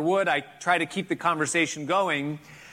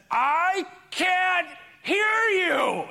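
frustrated shout; 13 semitones higher, much slower than usual. Retold or imitated. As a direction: blow up at last after many repeats. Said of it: "and I said".

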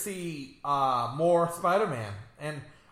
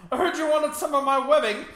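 mock British Spider-Man; 9 semitones higher, 4 dB louder, faster than usual. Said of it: "That accent's all over the place".

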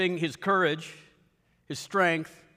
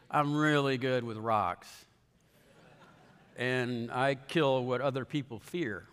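lower, matter-of-fact boast; deadpan David; 5 semitones lower, 3 dB quieter than usual. Retold or imitated. Preacher voicing David answering a churchgoer, lower and deadpan. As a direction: say it like it's obvious.

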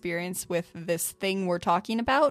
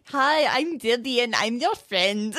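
lightly put-on bad German accent; terrible German accent; 2 semitones higher than usual. A hint of the fake German man's accent, quoting the doll line. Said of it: "He has such a terrible accent".

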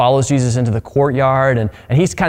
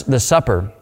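normal talking voice

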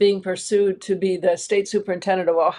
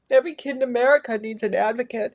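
ordinary delivery

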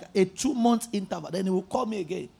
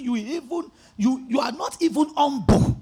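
ordinary talk

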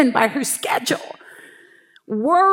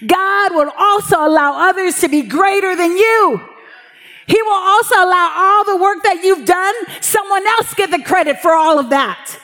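fiery preaching; 6 semitones higher than usual. Acted. Pastor urging the congregation about God's ways, preaching hard. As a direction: drive it home from the pulpit with rising fervor. Preaching rises to full force.

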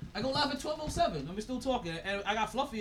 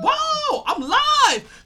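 excited shout; 14 dB louder, much slower than usual. Real, not acted. Surprised at going live.

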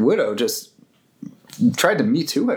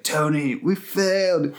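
higher, hammed-up solemn hero voice; mock grim Captain America; 6 semitones higher than usual. Podcaster mimicking Captain America to Tony, hammed-up solemn. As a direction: play up Cap's doom, slowing on the last words. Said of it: "He's like".

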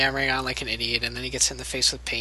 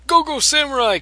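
higher, louder hero shout; morph call shout; 10 semitones higher, 5 dB louder than usual. Retold or imitated.

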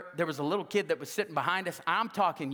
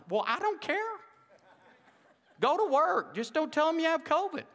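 higher, careless boss voice; mock boss; 8 semitones higher than usual. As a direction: toss it off like a boss who couldn't care less. Boss answering the sick worker.

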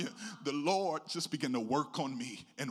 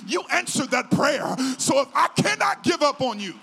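loud, high, impassioned sermon cry; full-force preaching; 6 semitones higher, 9 dB louder than usual. Real, not acted. Preacher retelling his vow to God for the church, full-force preaching. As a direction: build to a shouted declaration, then ease slightly.

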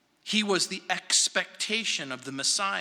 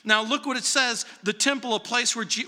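ordinary talk

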